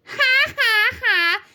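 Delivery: high, loud, witchy "ha ha ha"; mocking laugh. A narrator's reading. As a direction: laugh scornfully at the clumsy pupil. Witch jeers at fallen pupil.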